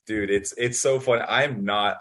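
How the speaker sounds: ordinary delivery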